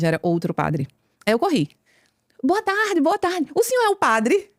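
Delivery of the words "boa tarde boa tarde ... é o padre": bright, hurried high-pitched hello to the priest; excited high voice greeting the priest; eager high greeting. Retold or imitated.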